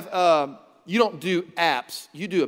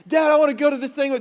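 put-on higher, louder, fast kid voice; kid begging dad; 7 semitones higher, 8 dB louder, faster than usual. Kid asking dad to go out, eager plea. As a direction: blurt it out like an eager child.

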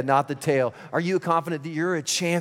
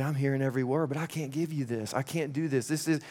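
ordinary talk

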